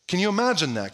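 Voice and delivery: ordinary delivery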